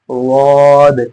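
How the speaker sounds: वाद said heavy and full-mouthed; heavy full waad. Shown as a demonstration. Teacher showing students the correct heavy वाद. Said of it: "इस तरह पढ़ना".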